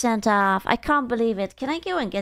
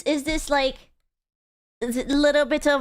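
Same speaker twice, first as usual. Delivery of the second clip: normal talking voice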